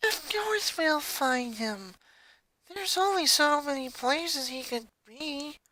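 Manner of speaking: soft, slow, worn-out grumble; too sleepy to argue properly; tired and cranky. A narrator's reading. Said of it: "equally tired but more cranky".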